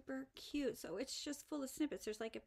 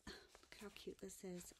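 hushed whisper; 2 semitones lower, 11 dB quieter than usual. Real, not acted. Showing viewers the gift, hushed whisper. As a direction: whisper in awe.

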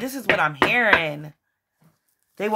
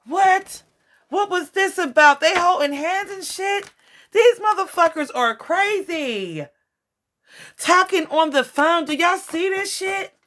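disbelieving excitement; 11 semitones higher than usual. Real, not acted. Tarot reader telling viewers about the fake friend, high-pitched disbelief. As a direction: squeal it like you can't believe the gossip. Reacting to shocking card reading.

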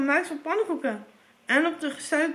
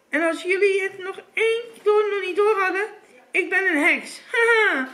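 raised witch voice ending in a cackle; gloating witch; 4 semitones higher, 3 dB louder than usual. A narrator's reading. Witch revealing herself to the children, gloating. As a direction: announce it with wicked relish.

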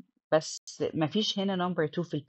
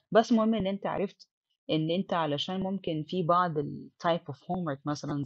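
usual speaking voice

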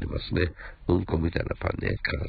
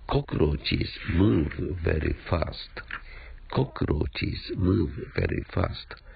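slow model sentence; much slower than usual. Shown as a demonstration. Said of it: "teacher models the sentence twice slowly".

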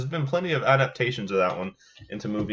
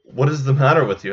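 louder, higher urgent question; alarmed question; 4 semitones higher, 9 dB louder than usual. Acted. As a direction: demand to know what happened. Seller questioning the frantic man.

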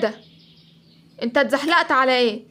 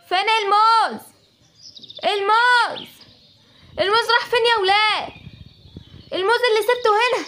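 higher, alarmed cries over stolen bananas; a monkey finds his hidden bananas gone, higher and frantic; shocked outcry; 6 semitones higher than usual. Acted. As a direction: panic about the missing bananas.

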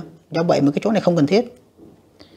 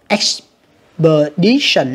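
slow Expedition, DI raised and punched; third-syllable stress; 4 dB louder, much slower than usual. Shown as a demonstration. Teacher showing the -tion stress rule, Expedition slowly stressed on di. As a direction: break Expedition into syllables, lifting di. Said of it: "Âm số ba ha".

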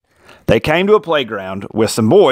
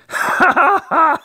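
high cartoon laugh. A narrator's reading. Valentine laughs.

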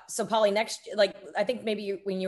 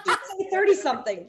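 laughing; 9 semitones higher than usual. Real, not acted. Jokes while laughing.

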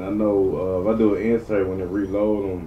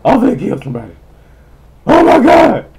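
much higher and louder, fast screeching; high-pitched furious screech; frustrated screech; 14 dB louder, faster than usual. Real, not acted. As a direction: scream it in exasperated mock rage. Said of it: "I can't hardly talk like that".

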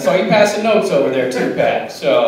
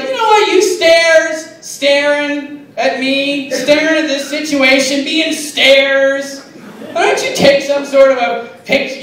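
mock angry sing-song; 7 semitones higher, slower than usual. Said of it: "I don't get angry much".

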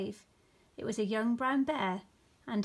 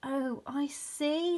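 slow, higher, understanding tone; kindly; 4 semitones higher, much slower than usual. A narrator's reading. Rabbit to Douglas, kindly. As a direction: draw it out with sympathy. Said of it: "said the rabbit kindly".

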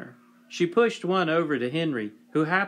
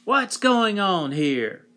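slow, loud, higher nasal shout; loud nasal yell; 5 semitones higher, 5 dB louder, slower than usual. A narrator's reading. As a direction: bellow it through the nose. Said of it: "yelled in a loud and nasal voice".

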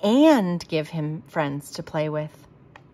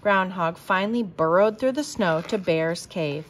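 plain reading voice

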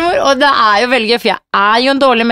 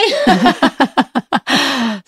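laugh; 4 semitones higher than usual. Real, not acted. Laughing at child's denial.

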